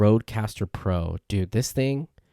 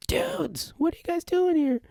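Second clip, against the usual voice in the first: much higher, delighted surprise; surprised high greeting. Retold or imitated. Running into the bar newbies at the coffee shop, surprised high voice. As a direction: greet old friends with a jump of surprise.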